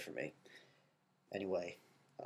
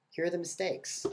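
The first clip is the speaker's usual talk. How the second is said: high mocking exclaim; 10 semitones higher, 6 dB louder than usual. Classmates' retort voiced in story.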